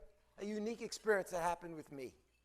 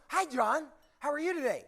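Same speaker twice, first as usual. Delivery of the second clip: higher, louder perky greeting; over-caffeinated cheer; 5 semitones higher, 4 dB louder than usual. Acted. Counter clerk greeting John by name, over-caffeinated and perky. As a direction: gush hello like an over-eager clerk. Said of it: "she had too much coffee".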